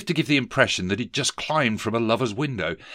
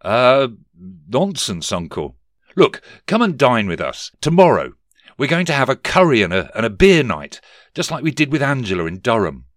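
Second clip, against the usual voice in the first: chirpy nephew; 3 semitones higher, 7 dB louder than usual. Acted. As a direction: chirp the invitation brightly. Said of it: "chirped Sir Keir".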